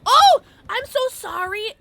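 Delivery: loud startled apology, high; sudden high shriek of sorry; startled yelp. Real, not acted. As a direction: jump out of your skin and apologize. Startled during chase.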